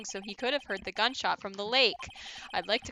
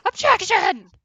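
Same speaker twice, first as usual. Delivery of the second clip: big, much higher and louder Objection!; shouted objection; 11 semitones higher, 14 dB louder, slower than usual. Acted. Defense lawyer objects before the judge, full shout.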